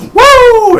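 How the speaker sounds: a high, loud Ric Flair woo; high woo yell. Retold or imitated. Imitating Charlotte's woo cry to the crowd, loud and high. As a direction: belt out a shrill woo. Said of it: "and she's like".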